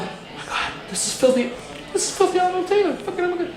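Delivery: breathless high starstruck gush. Retold or imitated. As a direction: gasp like meeting your idol. Starstruck reaction retold.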